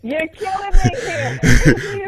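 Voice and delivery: loud, high words drawn out through laughter; helpless laughing protest, stretched out slowly. Real, not acted. Laughing at the other's teasing.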